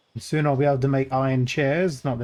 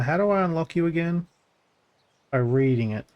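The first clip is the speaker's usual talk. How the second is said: usual speaking voice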